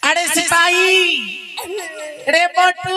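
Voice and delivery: calling out. Acted. King summons his fat soldier, calling out. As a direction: holler for someone out of sight.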